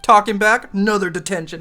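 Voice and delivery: hammed-up teacher scolding; mock strict teacher. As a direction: snap it like a fed-up teacher. Teacher handing out detention.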